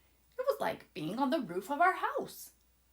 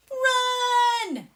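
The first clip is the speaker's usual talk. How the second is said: high, loud panicked yell; 14 semitones higher, 12 dB louder than usual. Acted. Leo and Hazel fleeing the eye, yelling. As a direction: scream it with all you've got. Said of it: "We yelled".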